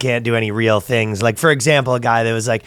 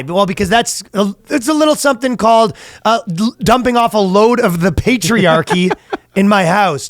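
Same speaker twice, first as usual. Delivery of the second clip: higher, hammed-up fatherly lecture; mock lecturing dad; 9 semitones higher than usual. Dad explaining his refusal to son, mock indignant.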